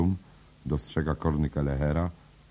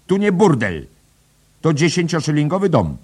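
loud, much higher madam voice; 13 semitones higher, 10 dB louder than usual. A narrator's reading. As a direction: boom it across the room. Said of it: "Bella donośnie".

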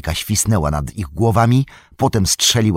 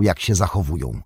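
ordinary delivery, nothing marked

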